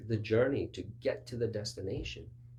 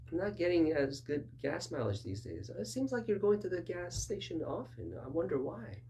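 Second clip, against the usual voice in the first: musing driver; 9 semitones higher than usual. Retold or imitated. Counselor leading question voiced.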